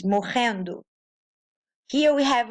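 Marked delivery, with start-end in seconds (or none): 0.0-0.8 morrendo with RR as a throaty H; Portuguese double R. Shown as a demonstration. Teacher explaining two middle R's, morrendo with H sound. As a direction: say morrendo like "mo-hen-do". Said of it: "sound is like the R in the beginning".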